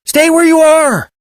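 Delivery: high, slow yell from far off. Acted. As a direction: call out to lost children, stretched and loud. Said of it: "one of the voices yelled".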